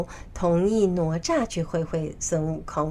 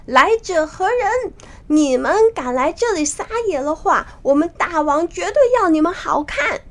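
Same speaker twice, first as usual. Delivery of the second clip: high, louder challenge; 8 semitones higher, 5 dB louder than usual. Acted. Monkeys at the cave challenge intruders, loud and high. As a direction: call out a threat, pitched well up. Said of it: "他们就大声地说".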